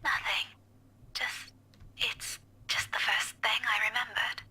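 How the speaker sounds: whispered. Acted. Whispered evasive answer.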